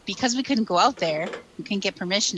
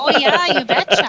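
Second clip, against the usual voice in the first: playful Minnesota "you betcha", louder and higher; Minnesota accent; 7 semitones higher, 9 dB louder than usual. Pretend. Guest teasing the host with a mock Minnesota accent. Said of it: "I only heard your accent".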